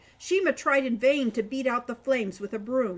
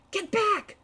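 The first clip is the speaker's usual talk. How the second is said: high, sharp shout of warning; 9 semitones higher, 3 dB louder than usual. A narrator's reading. Falcon shouting at Shima to get back. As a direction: yell it to get her away from the flames. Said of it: "Falcon shouted at Shima".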